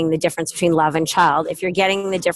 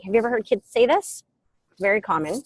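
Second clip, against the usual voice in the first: ordinary talk, nothing marked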